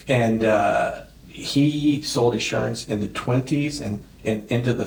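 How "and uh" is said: normal talking voice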